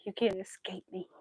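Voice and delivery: teasing whisper to the dog; breathy mock threat; playful whisper.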